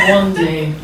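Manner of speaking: put-on low Russian-accented threat; scary Russian accent. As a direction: threaten like an intimidating Russian boyfriend. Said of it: "he had a very scary accent".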